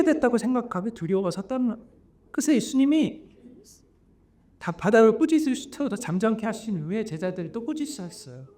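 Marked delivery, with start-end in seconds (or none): none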